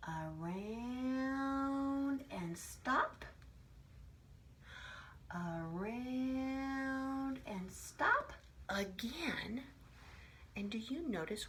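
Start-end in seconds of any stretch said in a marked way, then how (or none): 0.1-9.6 slow chant to guide drawing; teacher guiding children's pens, very slowly; very slow tracing. Shown as a demonstration. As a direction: draw each word out very slowly as the pen goes round. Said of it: "we're gonna go".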